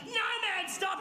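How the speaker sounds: high, urgent plea; mock panicked protest. Buyer begging the man heading for his car, panicked. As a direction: try to halt someone in alarm. Acted pleading as he runs to car.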